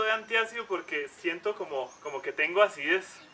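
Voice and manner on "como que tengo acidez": usual speaking voice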